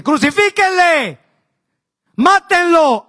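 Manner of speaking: high, slow mock crowd cry; mob yelling. The crowd crying out for his death, mock yell. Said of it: "empezó a gritar la gente".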